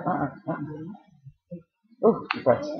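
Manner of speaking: usual speaking voice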